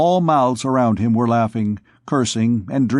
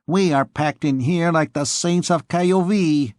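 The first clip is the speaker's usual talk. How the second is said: put-on Norman accent; 6 semitones higher than usual. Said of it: "recognizable by his nasal accent".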